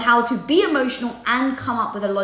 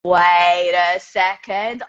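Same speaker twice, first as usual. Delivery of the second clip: very slow, louder, rising wait a second; drawn-out wait; 3 semitones lower, 6 dB louder, much slower than usual. Real, not acted. Recognizing a viewer's name, she says it slowly and louder. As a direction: stretch it out as recognition dawns. Spots someone she may know.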